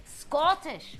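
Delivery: one word in amateur Scottish; unconvincing Scottish accent; attempted Scottish accent. Shown as a demonstration. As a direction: have a go at sounding Scottish. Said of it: "I'm not very good at it".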